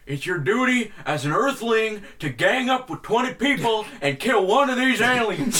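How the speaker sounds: high, slow, solemn mock address; president voice. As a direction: announce a national duty like a president. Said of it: "Joe Biden came on the T V and he said".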